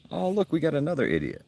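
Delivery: high mocking sneer; sarcastic scorn. Real, not acted. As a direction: roll your eyes at the troll.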